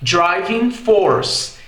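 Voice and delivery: slow American "driving force" with R; R sounded in "force", slow; American English. Shown as a demonstration. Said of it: "no inglês americano". ("driving force" americano, com R)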